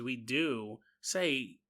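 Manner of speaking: ordinary talk, nothing marked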